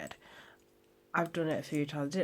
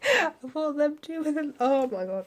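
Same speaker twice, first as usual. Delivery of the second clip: excited; 12 semitones higher, 8 dB louder than usual. Real, not acted. Reacting to the fight announcement.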